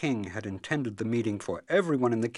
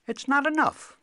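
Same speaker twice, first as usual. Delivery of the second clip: very high, louder cobbler voice; objecting cobbler; 9 dB louder than usual. Acted. Cobbler turns down the princess's hand, objecting. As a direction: refuse the offer in a high voice. Cobbler rejects the king's offer.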